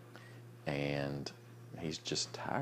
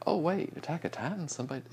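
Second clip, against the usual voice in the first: surprised viewer; 12 semitones higher than usual. Acted. Acts out a wondering viewer.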